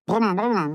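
rolling brumm with rising pitch; imitated motor noise. A narrator's reading. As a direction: play a child's pretend engine. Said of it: "Er schmiss den Motor an, machte".

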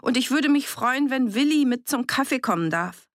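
high, carrying call. A narrator's reading. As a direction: call an invitation through the door. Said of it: "rief sie".

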